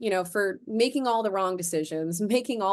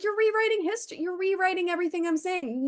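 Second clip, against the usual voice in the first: much higher, exasperated protest; exasperated God; 11 semitones higher, faster than usual. Retold or imitated. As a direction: scold her for twisting words. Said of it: "he's like".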